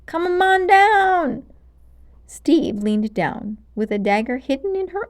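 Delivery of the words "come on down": coaxing witch voice. Acted. Witch luring with slow coaxing.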